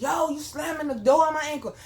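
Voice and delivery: loud, high-pitched indignant yell; re-enacting a shouted complaint; retold shout. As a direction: yell it out in protest. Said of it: "When I was like".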